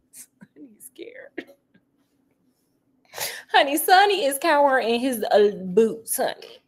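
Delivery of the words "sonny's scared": slow whispered aside. Pretend. Telling viewers Sonny is scared, in a slow whisper. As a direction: breathe it out like a secret. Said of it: "whispered aside".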